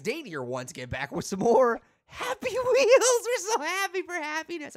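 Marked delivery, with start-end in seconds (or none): 2.1-4.8 high, squeaky mock-giddy cheer; silly high voice. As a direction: squeal it with over-the-top glee. Silly high-pitched show intro.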